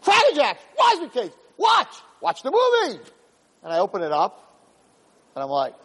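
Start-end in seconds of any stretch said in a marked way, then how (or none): 0.0-3.0 shouting match. Acted. Two angels fighting over the film, shouting high. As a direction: hurl the words like a brawl. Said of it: "And they're both fighting".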